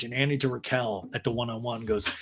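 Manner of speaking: normal talking voice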